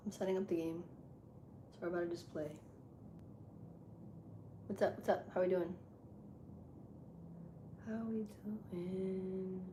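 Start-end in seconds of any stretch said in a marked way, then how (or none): none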